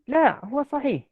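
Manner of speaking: La, huwa sahih in a light Arabic lilt, pitched high; a touch of Arabic accent on La, huwa sahih. Shown as a demonstration.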